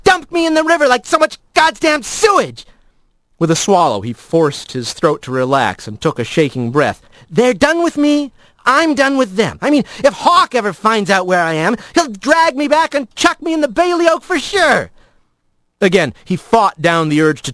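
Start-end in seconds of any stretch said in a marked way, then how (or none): 0.0-2.7 high, tight-throated hurt anger; tight, strained voice. Acted. Rat reminds Blaze of being thrown out, tight and bitter. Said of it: "His voice was so tight, he could barely make it work". 7.3-14.9 high, agitated, done with them; heated refusal. Acted. Rat tells Blaze they are finished, high and agitated.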